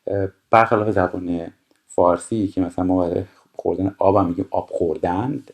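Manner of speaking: ordinary delivery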